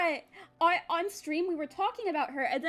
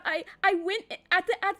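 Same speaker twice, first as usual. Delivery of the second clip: ordinary delivery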